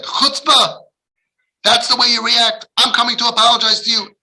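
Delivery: loud, high outburst of offended outrage; indignant scolding. Acted. Parent rebuking a child who rejected his apology, indignant and loud. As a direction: act out an affronted, high-pitched telling-off. Angry parent he could have been.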